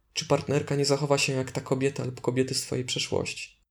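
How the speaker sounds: normal talking voice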